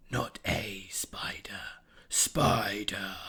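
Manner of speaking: slow breathy whisper; eerie whisper. A narrator's reading. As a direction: hiss the name like a threat. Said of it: "That word actually hurt".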